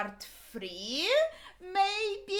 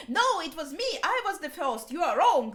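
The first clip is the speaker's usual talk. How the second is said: indignant protest; 5 semitones higher, 6 dB louder than usual. Retold or imitated. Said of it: "So, like".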